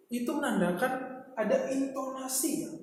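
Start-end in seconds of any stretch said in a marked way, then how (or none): none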